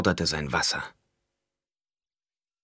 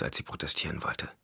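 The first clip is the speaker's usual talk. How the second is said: ordinary delivery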